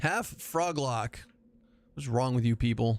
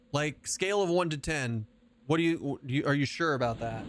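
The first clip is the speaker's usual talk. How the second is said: usual speaking voice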